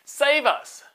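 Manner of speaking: high, loud desperate plea; crying out. Acted. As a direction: cry out to God for rescue. Israelites cry out to God.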